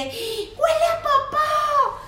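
put-on very high, slow, stretched kid's wail; drawn-out child yell. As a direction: drag it out like a toddler on the floor. Niece shouting in restaurant.

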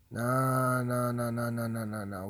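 long, slurred string of no's; fading, rolling chain of denial; drawn-out refusal. Real, not acted.

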